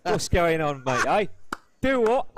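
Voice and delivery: loud, high Cockney geezer banter; Cockney accent. Acted. Guest shows off to the host, rowdy Cockney accent.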